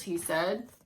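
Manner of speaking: usual speaking voice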